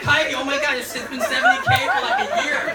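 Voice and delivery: reliving his shock at her, laughing; exasperated laughing. Retold or imitated. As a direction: squeal it in laughing disbelief.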